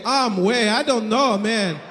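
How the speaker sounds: imitated Haitian accent, lamenting. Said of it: "I can do the accent".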